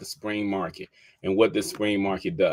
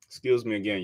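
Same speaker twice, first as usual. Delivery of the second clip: sneeze; 4 dB quieter, faster than usual. Real, not acted. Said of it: "I don't know why I keep sneezing".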